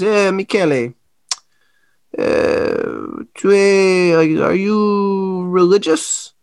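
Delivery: put-on slow, drawn-out Italian-accented question; a light Sicilian accent, slow and curious. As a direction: ask it like an old Sicilian guide, slowly. Vito asking in Italian accent.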